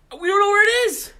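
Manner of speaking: loud, high cry of alarm; the cup is gone, shout it. Acted. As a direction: blurt it out in a panic. Alarmed report of stolen cup.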